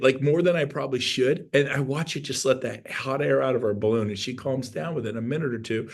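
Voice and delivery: usual speaking voice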